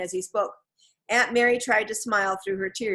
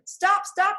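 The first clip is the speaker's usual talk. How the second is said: high yell after the wagon; 12 semitones higher, 4 dB louder than usual. A narrator's reading. Kids chasing the wagon, yelling high. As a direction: shout to the driver to stop. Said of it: "they both yelled".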